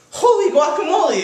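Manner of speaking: high, loud cartoon exclamation; high-pitched kitten outburst; exclaiming. A narrator's reading. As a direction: cry it out in big astonishment. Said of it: "exclaimed Skippy John Jones".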